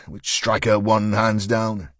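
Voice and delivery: ordinary delivery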